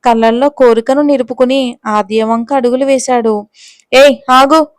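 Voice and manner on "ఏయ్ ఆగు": louder cry of ఏయ్, ఆగు, hand raised to stop him; cornered woman shouting stop. Acted. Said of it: "గట్టిగా అరిచింది ఆద్య".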